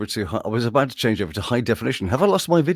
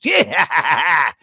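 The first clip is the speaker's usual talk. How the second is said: cartoon villain cackle; gloating baddie laugh; 6 semitones higher, 3 dB louder than usual. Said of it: "the naughty person called Grandmaster Glitch".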